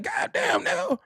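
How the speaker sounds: high, shocked God damn; stunned reaction. Retold or imitated. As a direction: gasp it out like you can't believe the video. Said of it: "I'm like".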